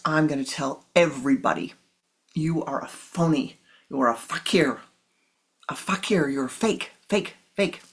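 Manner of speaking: loud scornful name-calling; furious public denunciation; angry shouting. Acted. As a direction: shout it over your shoulder. Father stomps away yelling.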